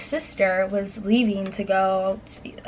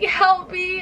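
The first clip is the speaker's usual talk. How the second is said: high-pitched mock cry for help; mock scream; 9 semitones higher than usual. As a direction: retell a panicked yell without full volume. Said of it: "I was, like, screaming at her".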